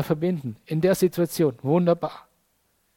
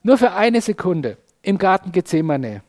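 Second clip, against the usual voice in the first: ordinary talk, nothing marked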